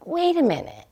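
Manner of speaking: surprised. Retold or imitated. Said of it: "And I was like".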